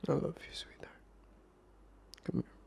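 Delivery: soft, whispery endearment; tender. Acted. Boyfriend making up with his sweetheart, soft and tender. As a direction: draw them close with a hushed voice. Making up with partner after quarrel.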